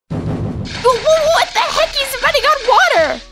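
high, stammering shock; flabbergasted, tripping over the first word; stunned disbelief. Acted. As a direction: blurt it out in astonishment. Sees master running on water.

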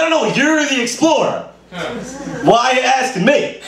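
exasperated retort. Retold or imitated. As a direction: throw the question back, fed up. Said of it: "It's like".

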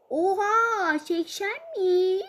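put-on drawn-out childish disbelief; amazed kid. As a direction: gape at the idea of eighty.

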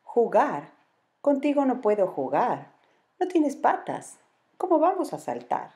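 sulky jaguar mocking the anaconda; grumpy jaguar. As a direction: scoff at the legless snake. Omar refuses the anaconda.

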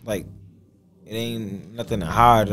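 usual speaking voice

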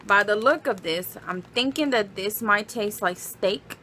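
ordinary talk

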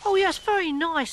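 high-pitched little frog; high storybook frog voice; Sylv's character voice. Acted. Frog Sylv's line in children's story.

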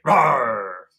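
bear roar. Shown as a demonstration. Teacher growling like a bear for kids. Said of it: "growl like a bear".

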